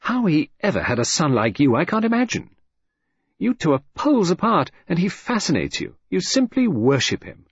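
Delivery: wife's sudden exasperated outburst at her husband. A narrator's reading. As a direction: burst out at him, pitch jumping up. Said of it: "She cried out suddenly".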